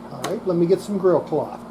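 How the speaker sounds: usual speaking voice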